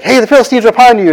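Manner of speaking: high, fast alarm, half-shouted; mock alarm call. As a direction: call out the warning quickly. Delilah raises the alarm.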